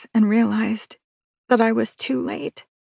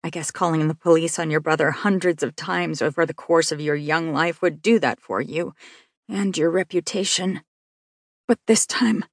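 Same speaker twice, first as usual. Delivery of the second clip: usual speaking voice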